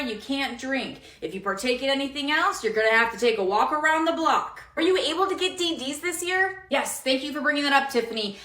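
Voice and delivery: normal talking voice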